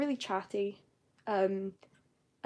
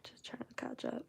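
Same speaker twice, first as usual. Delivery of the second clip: breathy whisper, much softer, to herself; whispered aside; 13 dB quieter than usual. Real, not acted. Streamer reading chat, whispering to herself. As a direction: murmur it under her breath while catching up.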